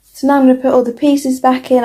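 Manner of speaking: ordinary delivery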